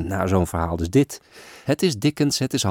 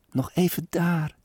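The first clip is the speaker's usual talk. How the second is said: put-on child exclaiming; 9 semitones higher than usual. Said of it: "zo roept zij uit".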